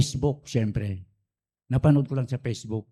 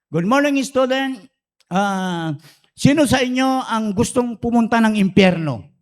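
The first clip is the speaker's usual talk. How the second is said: bright teacher voice; 7 semitones higher, 5 dB louder than usual. Retold or imitated. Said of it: "Sabi naman niya".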